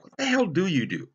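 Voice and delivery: disbelieving dad; dad's incredulous demand. Retold or imitated. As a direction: throw it at him like a baffled father. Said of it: "He'd say things like".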